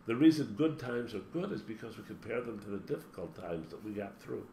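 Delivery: usual speaking voice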